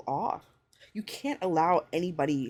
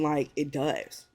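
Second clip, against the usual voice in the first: usual speaking voice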